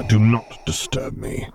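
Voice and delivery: a touch of Vader's final order; slow Vader command. Acted. Vader leaving for his meditation chamber, slow order.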